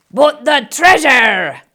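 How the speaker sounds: slow, loud dismayed cry; booming alarmed captain; holler. Acted. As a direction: holler over the wind. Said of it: "hollered Braidbeard".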